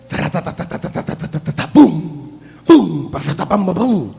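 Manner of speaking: low, hushed rat-a-tat gunfire noises; imitated gunfire. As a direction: mimic distant shooting in the night. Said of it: "lo único que escucha en la noche es".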